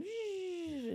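high buzzing sound effect; imitated toy noise. Little girl playing in her stroller, high buzzing noise. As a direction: buzz like a kid playing with a gadget. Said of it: "dangling something and going".

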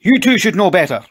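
high, loud scolding yell; angry shout. A narrator's reading. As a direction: shout it after fleeing children. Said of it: "he shouted".

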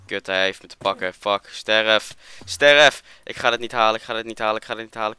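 loud, frantic combat yelling; panicked shouting. Real, not acted. Caught by mob, fighting for life. (luid, paniekerig geschreeuw)

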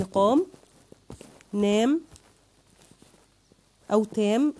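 a teacher calling out rhyming words, each one clear and punched; rhyming words stressed. Shown as a demonstration. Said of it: "إحنا بنجيب على نفس الوزن".

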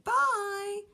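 high, loud shouted farewell; shouted goodbye. A narrator's reading. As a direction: call it out high and loud, holding the word. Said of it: "the shark shouted".

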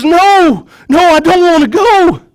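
high, loud cry of refusal; scared protest. Acted. Scared prisoner refuses to leave his cell, crying out high and loud. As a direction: cry out in fear at being taken. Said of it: "He looks all scared".

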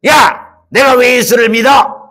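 scoffing outburst. Acted. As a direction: snap back in mocking disbelief. 부자가 발끈함. (비웃으며 버럭 소리치는 부자)

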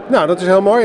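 ordinary delivery

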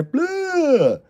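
mock disgust blurt. Mocking Ponchos restaurant.

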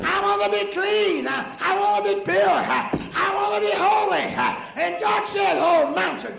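high, shouted preacher's cry, stretched out; full-force preaching. Acted. Preacher crying out to the congregation, full-force shouted preaching. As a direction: preach it like the church is on fire.

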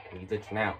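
ordinary talk

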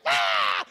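low, loud mock yell Ah. Son imitating his fiery mom, low mock yell. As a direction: bark a fiery mom's Ah. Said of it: "She's like".

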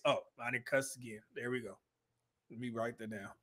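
quiet note to self; muttered aside. Real, not acted. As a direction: murmur to yourself while fiddling with the video. Aside to self while cueing video.

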